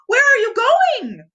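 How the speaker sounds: high, loud call after a friend; Pooh crying out. A narrator's reading. Narrator voicing Pooh calling after Piglet, high and loud. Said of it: "Pooh cried".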